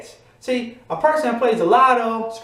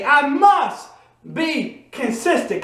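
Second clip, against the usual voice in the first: slow, split after must, higher; slow declaration; 4 semitones higher, much slower than usual. Shown as a demonstration. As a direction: drag it out for the crowd to echo. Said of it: "Come on, say it with me".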